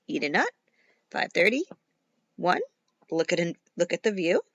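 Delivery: usual speaking voice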